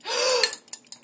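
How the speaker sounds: sharp high gasp of mock shock. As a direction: gasp as if something just went wrong. Sees green egg in glass.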